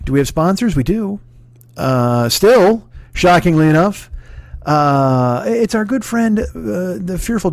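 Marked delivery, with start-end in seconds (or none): none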